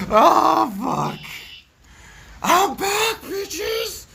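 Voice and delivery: high, loud triumphant shout; excited yell. Real, not acted. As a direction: yell it out like a comeback. Triumphant return shout to viewers.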